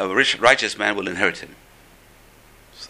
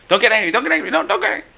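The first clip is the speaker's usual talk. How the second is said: high, rushed pleading; 11 semitones higher, 4 dB louder, much faster than usual. Retold or imitated. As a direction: beg him not to get angry, pitched way up. Servant begs rabbi not anger.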